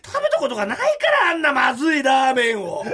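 loud, mocking put-down; snide sneer. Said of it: "と言いました".

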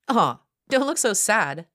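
high mock sympathy; taunting. A narrator's reading. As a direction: taunt with fake pity. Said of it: "taunted Monica".

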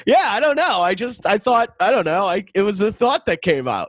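high-pitched sheepish self; sheepish younger self. Retold or imitated. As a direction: squeak out an awkward excuse. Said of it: "And I went".

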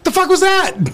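high, fast complaint; indignant, high and fast; mock indignant. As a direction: demand why he didn't help. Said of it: "That's why I was like".

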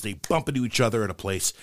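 usual speaking voice

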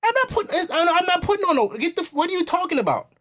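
very high, exasperated sputter; re-enacted indignant refusal. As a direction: sputter in high-pitched disbelief. Re-enacts refusing her demand.

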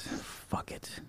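breathy whispered give-up; voiceless muttered surrender; whispered resignation. Retold or imitated. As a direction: let it go under your breath. Driver giving in, retold.

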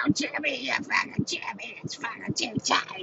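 high, thin strangled squeal; mock choked scream. Woman to lover over a too-tight chin strap, mock choked squeal. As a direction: squeal softly as if you can barely breathe. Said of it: "she's screaming".

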